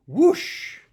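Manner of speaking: loud high whooshing sound effect. Acted. Reading the glider launch to kids, loud whoosh. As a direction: blast out a big whoosh. Glider goes over cliff.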